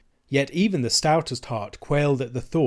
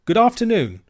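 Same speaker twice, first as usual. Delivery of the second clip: hasty; 10 semitones higher, 6 dB louder than usual. Acted. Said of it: "said Robert hastily".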